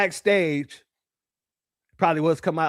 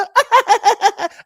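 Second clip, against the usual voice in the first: loud high laughing burst; 8 dB louder than usual. Real, not acted. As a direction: cracks up at own impression. Laughs at own impression.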